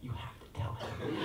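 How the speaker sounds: imitating a child's whispered urging; urgent whisper. Daughter nudging her dad to tell the man, whispered. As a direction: whisper it to Dad like a little secret.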